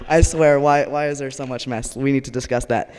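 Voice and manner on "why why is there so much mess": ordinary delivery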